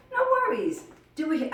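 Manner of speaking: very high, loud sing-out; cheery reassurance. Retold or imitated. Teacher to someone who can't lift knees, cheery reassurance.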